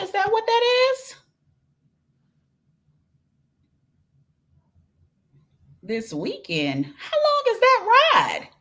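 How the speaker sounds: can't believe she's driving that far; amazed disbelief. Real, not acted. Shocked at a long road trip.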